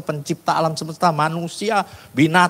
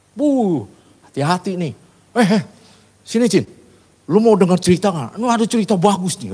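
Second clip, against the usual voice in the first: loud, raised mocking taunt; teasing; 5 semitones higher, 5 dB louder than usual. Coworker taunting the preacher over his talk of God, teasing. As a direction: call out a jeering tease. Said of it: "saya diledekin".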